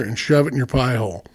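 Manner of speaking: ordinary delivery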